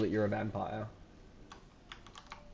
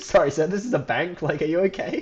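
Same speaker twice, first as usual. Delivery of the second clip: louder, higher polite clerk; bank clerk; 6 semitones higher, 7 dB louder than usual. Retold or imitated. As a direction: play a baffled bank clerk. Bank clerk voiced again.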